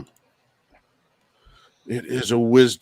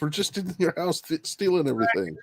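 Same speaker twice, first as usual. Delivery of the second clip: high-pitched, quick mock protest; 12 semitones higher, 3 dB louder than usual. Joking intruder calls after the kid, mock protest. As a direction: plead in a high comic voice, laugh creeping in.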